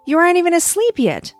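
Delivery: put-on high, fast child voice; eager little girl. Lucy asks the star.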